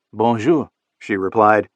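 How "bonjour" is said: French greeting. Acted. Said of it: "that glorious French accent".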